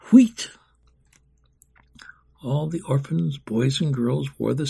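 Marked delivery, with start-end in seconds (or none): 0.1-0.5 1 whistle call imitation. A narrator's reading. Whistle signal in the courtyard.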